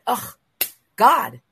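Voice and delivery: high, loud plea of defeat; retold exasperated cry to God; despairing. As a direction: groan it out, fed up and overwhelmed. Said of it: "that moment where I just went".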